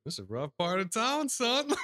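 high, loud mock scolding; imitated scolding lady. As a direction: play the lady sternly warning a youngster. Retold woman scolding the speaker.

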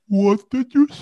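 what did you say? asked lightly, mocking; mock calm question. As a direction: play it dumb and unbothered. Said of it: "calmly just listening".

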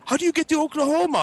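high confused-stranger voice; lost driver. Retold or imitated. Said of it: "He's like".

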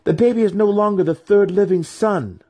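high, loud declaration; announcing. A narrator's reading. Maya announcing her brother's death, high loud proclamation.

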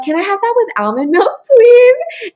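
high-pitched polite ask, rising at the end; re-enacted cutesy customer order; sweet hopeful request. Acting out her hopeful order.

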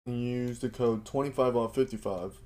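upbeat sponsor read for listeners. Acted. Host pitching Built Bar to his listeners, ad read. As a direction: sell the protein bar like a radio spot.

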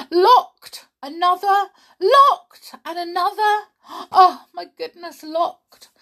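high, loud, frantic exclamations; desperate high cries; rising panic. A narrator's reading. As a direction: panic at each locked door. Desperate try at doors.

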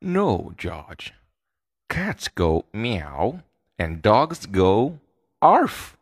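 gently firm mother, teaching a puppy; patient mother coaching her pup. Acted. Mother scolding George in a story.

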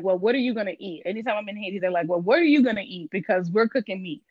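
usual speaking voice

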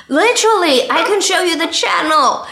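high and loud eager offer; excited. Real, not acted. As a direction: jump in, keen to show it. Eager to prove her point.

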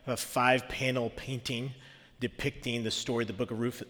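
ordinary talk

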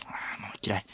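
very soft, voiceless grumble; whispered aside. Acted. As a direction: drop to a whisper to complain. Muttered aside after a gag.

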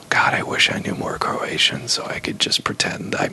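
whisper. Real, not acted.

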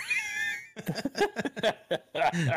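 mechanical robot laugh, high; a stiff, programmed robot cackle. Pretend. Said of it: "I gotta do a robot laugh real quick".

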